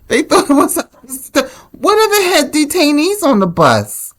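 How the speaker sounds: high, squeaky voice through giggles; real laughing. Real, not acted. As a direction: barely get it out for laughing. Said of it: "I don't know why I'm laughing".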